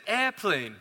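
Airplane with the British sound, ɛpʰleɪn, no r in air. Retold or imitated. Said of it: "I said".

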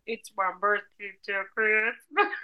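slow, strange put-on comic voice; a drawled, hammed-up oddball voice; Mister Bean. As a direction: drag the words out in a silly voice. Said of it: "a duet between Kermit and Mister Bean".

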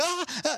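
high-pitched imitated yelp; mock yell. Storyteller imitates the girl running away, mock yell. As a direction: yelp high without real volume. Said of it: "like, yells".

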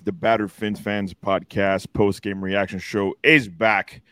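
booming announcer intro; big arena announcer voice; grand entrance announcement. Acted. Podcast opening announcer intro.

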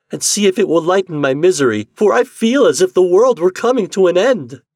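high, loud, agitated rush; anguished storybook foreboding; desperate wife. Acted. Desperate wife fears world's end.

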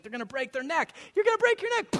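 sudden high, loud panicked cry; panicked mom. Retold or imitated. Preacher imitating his wife watching the boys crash, high alarmed cry. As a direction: shriek the warning like a mom watching a fall. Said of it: "she's like".

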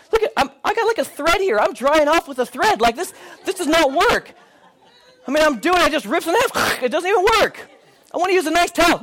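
high, whiny mock protest; exasperated complaint. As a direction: whine like a hard-done-by husband. Husband complains about guest towels.

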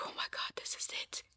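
hushed, quick whisper of realization; awed whisper. Retold or imitated. Speaker overcome during prayer at church, retold awed whisper. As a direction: whisper it as if overwhelmed. Overwhelmed by love while praying.

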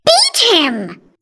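high, loud vicious command; harsh order. A narrator's reading. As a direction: call out the punishment forcefully. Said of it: "menacing order from Aunt Sponge said".

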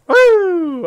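loud high whoop of glee; excited cheer. Real, not acted. GM cheers at a hit.

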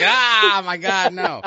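loud, high hammed-up cry of dismay; mock horrified outburst. Host imagining hearing about porn sites again, mock horrified yell. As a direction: yell it in mock horror, breaking into laughter.

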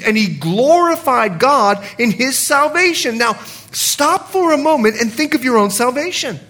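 ordinary delivery

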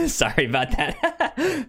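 high, giggly apology through laughter; laughing apology. Real, not acted. Host laughing at his own date mix-up, giggly apology. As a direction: laugh it off, embarrassed at the slip.

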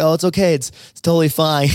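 high, breezy mock husband; husband shrugging off the yelling, in a high, breezy mock voice; imitating the husband. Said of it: "He's like".

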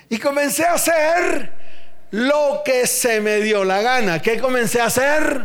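full-force preaching shout. Real, not acted. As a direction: bellow it at the congregation.

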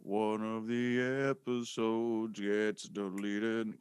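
slow soft teasing parrot; mocking slow echo. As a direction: mimic him slowly and quietly. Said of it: "mocking echo, slow soft".

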